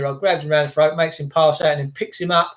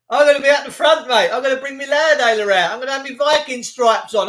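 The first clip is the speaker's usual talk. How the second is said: ordinary talk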